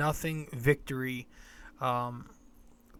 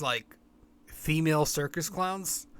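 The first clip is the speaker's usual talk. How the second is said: ordinary talk, nothing marked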